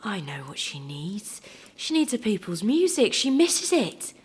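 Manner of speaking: dawning realisation that bursts out high; sudden revelation. A narrator's reading. Tired mother realises what her baby needs, excited revelation. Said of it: "her eyes widened with revelation".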